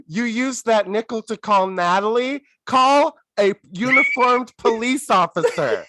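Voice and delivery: high, emphatic comic scolding; exasperated insistence. Acted. Telling film girls whom to phone, exasperated insistence. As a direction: hammer home the obvious, voice climbing.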